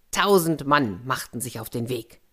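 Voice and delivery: ordinary delivery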